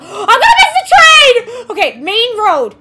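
high, loud laughing panic; hammed-up panic rushing to the main road; panicked shout. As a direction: gasp and yell about missing the train. Voicing panicked protagonist.

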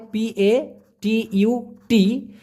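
"P A T U T" said very slowly, letter by letter; slow spelled-out P A T U T; spelling out letters. Shown as a demonstration. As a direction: spell the word slowly and clearly. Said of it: "সেটা হবে পাতুত".

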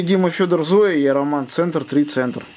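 ordinary delivery

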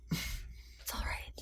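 slow soft whisper; soothing whisper. Acted. Therapist calming the upset teen, whispered. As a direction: hush him gently, almost breathless.

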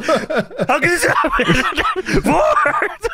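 high, drawn-out wail through laughter; mock outrage. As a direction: imitate an outraged announcer, cracking up. Mimicking frantic commentator's outrage, laughing.

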